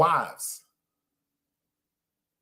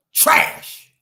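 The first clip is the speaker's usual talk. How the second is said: louder, breathy scornful trash; scornful loud verdict; 7 dB louder than usual. Real, not acted. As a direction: slam it down as the final word. Verdict, much louder now.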